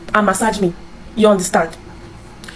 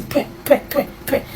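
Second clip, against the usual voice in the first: soft unvoiced spitting noises, pwe pwe; mock spitting; 4 semitones higher, 7 dB quieter than usual. Mimicking mother spitting in disgust, soft pwe sounds. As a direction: spit quietly in disgust like mom did. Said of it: "She was not spitting".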